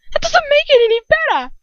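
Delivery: very high, loud yell; shout. A narrator's reading. Draco shouting at his father in outrage. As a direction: explode at him. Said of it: "Draco shouts".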